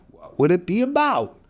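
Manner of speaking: plain reading voice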